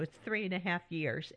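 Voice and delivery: normal talking voice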